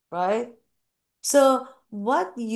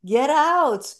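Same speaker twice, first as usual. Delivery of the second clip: higher-pitched mock order to get out; mock urging; 8 semitones higher, 3 dB louder than usual. Teacher urging a sufferer out of boiling water, mock urging. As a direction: urge them out, half in jest.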